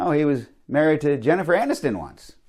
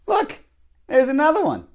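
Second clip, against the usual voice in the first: much higher, squeaky excitement; high excited squeal; 11 semitones higher than usual. Acted. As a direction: squeal like you spotted something.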